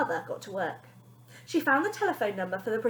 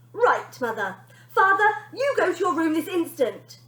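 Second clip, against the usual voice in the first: louder, higher commanding brat; bossy girl; 5 semitones higher, 5 dB louder than usual. Acted. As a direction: order the parents about like a tyrant. Bonnie orders her parents around.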